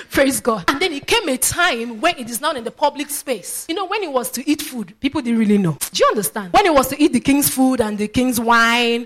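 ordinary talk, nothing marked

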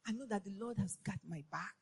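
ordinary talk, nothing marked

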